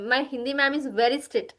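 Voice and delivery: usual speaking voice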